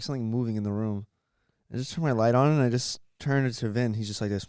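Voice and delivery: ordinary delivery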